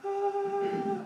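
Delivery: high squeaky mock panic gasp; a shrill high mock gasp; panicked animal gasp. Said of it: "Is it going".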